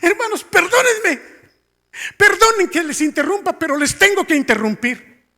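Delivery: loud, high-pitched public interruption; high, forceful mock announcement to the congregation; imitating interrupting dad. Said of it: "Así, mire".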